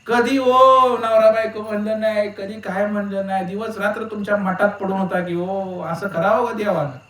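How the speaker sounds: hammed-up widow blaming the guru; wife crying out. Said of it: "ती बाई ओरडायला लागली".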